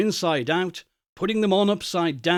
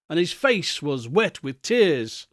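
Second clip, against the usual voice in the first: ordinary delivery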